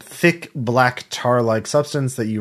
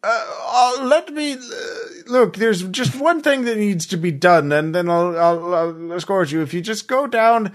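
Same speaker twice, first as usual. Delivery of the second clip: high-pitched, hesitant pleading in character; wheedling uncle character; 10 semitones higher than usual. Acted. Uncle asks niece to pull levers, high wheedling voice. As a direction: coax them in a high, halting uncle voice. Game master plays the uncle.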